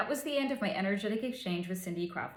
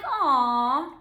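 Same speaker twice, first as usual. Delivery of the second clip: drawn-out, imitated "aw" of being touched; cooing aw; 3 semitones higher, 6 dB louder than usual. Reacting to his gushing over his wife, cooing aw. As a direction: coo a long aw. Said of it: "And I was like".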